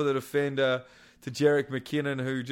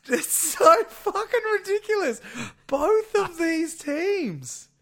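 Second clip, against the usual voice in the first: much higher and louder, cracking with laughter; exasperated laughing outburst; 14 semitones higher, 5 dB louder than usual. Real, not acted. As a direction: squeal it out, half crying with laughter at the absurdity. Exasperated laughing rant about league.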